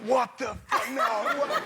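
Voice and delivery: mock stunned disbelief, laughing; hammed-up gasp of surprise; shocked reaction. Backstage crew learning the host is forty, laughing disbelief. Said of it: "everyone was like".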